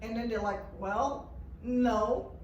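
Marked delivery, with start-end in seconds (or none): none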